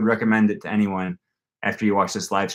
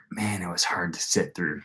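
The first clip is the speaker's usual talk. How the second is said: whisper; 3 semitones lower, 9 dB quieter than usual. Real, not acted. Bored in beginner class.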